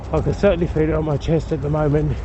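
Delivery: panting between phrases after a climb; out of breath. Real, not acted. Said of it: "huff and puff like this".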